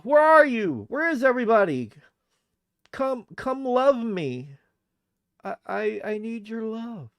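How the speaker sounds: high, pleading lonely streamer in broken English; hammed-up lonely character, pleading; needy put-on voice. Same put-on voice resumes.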